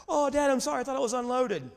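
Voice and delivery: mock-panicked apology, pitched high; panicked son apologizing. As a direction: imitate a boy pleading with dad. Son's panicked apology retold.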